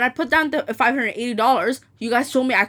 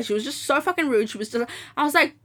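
ordinary talk

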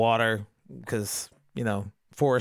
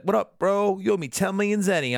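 higher, hammed-up fortune teller; jokey higher-pitched character voice; mock brash greeting; 7 semitones higher than usual. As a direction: play a pushy hustler, lightly voiced. Voicing fortune teller showing up.

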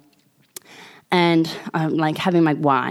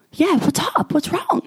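high-pitched worried question; retold alarm, pitched high; mock concern; 11 semitones higher than usual. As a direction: imitate a startled, concerned seatmate. Retold seatmate asks, alarmed.

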